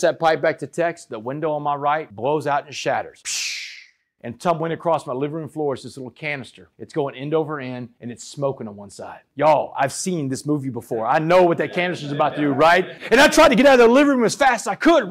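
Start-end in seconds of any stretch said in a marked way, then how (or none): 3.2-3.5 sharp hissed shatter noise; glass-shattering sound effect. Retold or imitated. Speaker imitates the window blowing out, shattering noise. 13.1-15.1 fast, loud and high rush; frantic retelling. Retold or imitated. Storyteller scrambling out of the living room, frantic rush.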